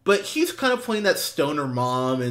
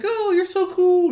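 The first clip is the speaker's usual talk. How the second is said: very high, swooning mock gush; gushing admirer. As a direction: coo over him like a starstruck date. [name] gushing at date.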